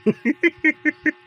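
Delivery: quick amused laugh; short laugh. Real, not acted. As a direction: burst out laughing briefly. Laughing at groom's mishearing.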